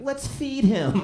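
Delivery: squeaky high, bright offer to help; high eager girlfriend. Retold or imitated. Girlfriend to homeless man, high mock-sweet voice. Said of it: "she's like".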